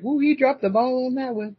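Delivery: high teasing ooh, he dropped the ball; mock disappointment. To her co-host, high teasing mock disappointment. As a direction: tease him, pitched high and amused. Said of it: "I was like".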